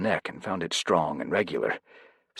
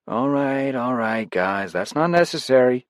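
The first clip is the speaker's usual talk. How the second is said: higher, louder smug remark; higher, louder smug comment; amused John; 7 semitones higher, 4 dB louder than usual. A narrator's reading. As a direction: tease them over the earpiece. John teasing over the earpiece.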